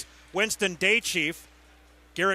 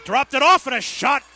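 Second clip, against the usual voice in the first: sudden lift of excitement on the shot; excited call; 6 semitones higher, 3 dB louder than usual. Real, not acted. Hockey announcer reacting to a shot, excited rise. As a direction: voice jumps up as the shot goes in. Shot on goal during power play.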